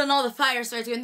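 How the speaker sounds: usual speaking voice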